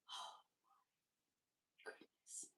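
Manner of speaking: breathless, very soft oh my goodness; awed whisper. Real, not acted. Seeing the doll she is unboxing, awed whisper. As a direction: whisper it as if stunned by beauty.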